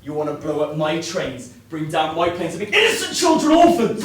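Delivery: rising from heated to a full, high yell; angry accusation swelling into a shout; building to a furious shout. Acted. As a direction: let the anger climb until it breaks into a scream. Heated argument with prisoner.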